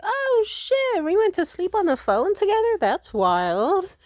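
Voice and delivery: very high, loud astonished cry; just woke up and can't believe it; surprised squeal. Retold or imitated. She wakes up surprised.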